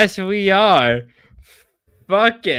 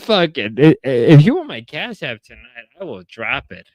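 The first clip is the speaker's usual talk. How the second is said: ordinary talk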